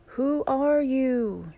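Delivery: high, slow yell, each word drawn out; curious yell. A narrator's reading. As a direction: call it out loudly across the mountains. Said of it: "Curious, he yells".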